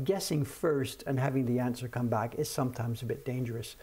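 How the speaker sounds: normal talking voice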